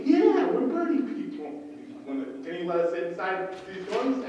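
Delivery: high, loud, eager yeah; enthusiastic. Retold or imitated. Campaign volunteers answer a stranger at the gate, enthusiastic. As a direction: pitch it up like a keen campaign volunteer. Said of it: "we have to be enthusiastic".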